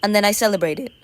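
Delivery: ordinary delivery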